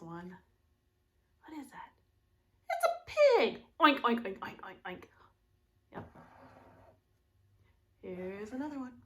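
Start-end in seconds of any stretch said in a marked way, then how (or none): 3.8-5.0 oinks fading away; oinking pig. Shown as a demonstration. Teacher oinking like a pig for kids. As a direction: snort like a pig.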